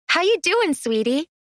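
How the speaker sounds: high, sing-song pet talk; sweet voice for the three-headed pup; cooing to a pet. Acted. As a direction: coo it like greeting a puppy. Greeting a beloved pet.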